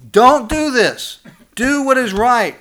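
high, loud, slow plea to the congregation; loud pleading. Real, not acted. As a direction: call it out slowly, pressing them to obey. Pastor pleads God's warning.

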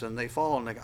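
ordinary talk

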